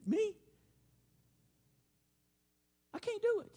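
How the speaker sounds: a cornered man squeaking out his refusal; panicked protest. Acted. Moses begging God to pick someone else, high panic. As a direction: voice jumps way up on the refusal.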